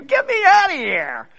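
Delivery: high, loud, whining plea from a jail cell; whiny prisoner complaint. Retold or imitated. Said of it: "if I'm in prison, expect to get a letter".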